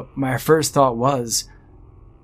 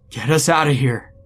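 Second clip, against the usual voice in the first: moderately raised, higher plea; panicked shout; 5 semitones higher, 4 dB louder than usual. A narrator's reading. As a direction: call out urgently to be rescued. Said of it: "he shouted at me".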